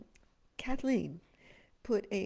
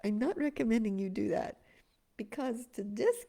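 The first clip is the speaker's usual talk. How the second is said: normal talking voice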